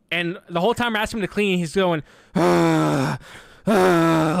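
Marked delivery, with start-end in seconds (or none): 2.3-4.4 loud exaggerated groaning sighs; mock put-upon groans; annoyed sighing groans. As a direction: groan like a lazy roommate asked to clean. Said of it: "I don't wanna hear you make sighing sounds".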